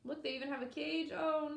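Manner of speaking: usual speaking voice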